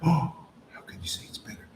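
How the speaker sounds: gasp, then a quick whispered protest; shocked hushed whisper, hurried; gasping mock whisper. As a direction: play the shocked objector, breathless and hushed. Incredulous objector, gasp, whisper.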